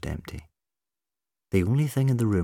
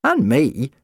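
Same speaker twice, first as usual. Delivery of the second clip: high, loud, put out; peeved, high-pitched reminder; miffed; 7 semitones higher, 7 dB louder than usual. A narrator's reading. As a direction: butt in, indignant at being left out.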